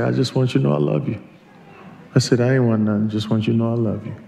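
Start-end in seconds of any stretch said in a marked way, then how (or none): 0.0-1.2 soft, quick, casual confession; tender message. Acted. Models a tender text message. 2.4-4.1 low, soft, offhand reassurance; quiet, sincere aside to a loved one; tender message. Acted. Models a tender text message.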